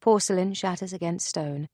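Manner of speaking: ordinary delivery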